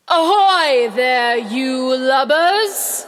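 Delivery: slow, drawn-out pirate captain call; Hook impression. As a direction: boom it across the water like Hook. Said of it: "Wendy, watch this".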